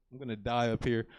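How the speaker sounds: ordinary talk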